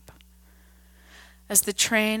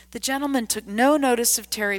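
usual speaking voice

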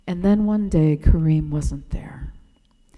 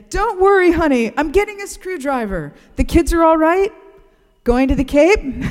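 much higher, louder fond mechanic's voice; Kareem reassuring; 9 semitones higher, 5 dB louder than usual. Retold or imitated. Attendant calming the narrator about her taillight, raised fond voice. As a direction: soothe a customer like family, pitched well up. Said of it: "And he'd say".

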